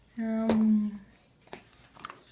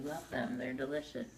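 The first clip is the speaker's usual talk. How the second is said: much lower silly coaxing voice; deep silly voice; 11 semitones lower, 5 dB quieter than usual. Pretend. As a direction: drop into a goofy low voice. Coaxing baby to eat.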